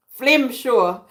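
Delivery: "Flim show" with a laugh, flim not film; Jamaican flim show. Shown as a demonstration. Laughing at old Jamaican word, "Flim show" as flim. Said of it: "we say flim".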